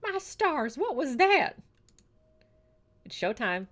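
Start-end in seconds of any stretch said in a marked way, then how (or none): 0.0-1.5 high-pitched startled outburst. Real, not acted. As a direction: react high and drawn out to a sudden noise. Reacting to a noise in game.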